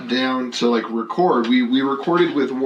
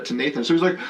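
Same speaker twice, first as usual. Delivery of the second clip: ordinary delivery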